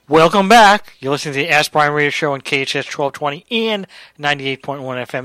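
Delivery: loud, bright announcer welcome; radio welcome back. Acted. Host greeting listeners after the break, loud announcer read. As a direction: punch the welcome back on air.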